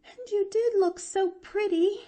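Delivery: put-on high, near-tearful girl; almost break into sobs; near-sobbing. A narrator's reading. Girl almost sobbing as she stumbles out of her aunt's room, high. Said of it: "almost sobbed Pollyanna".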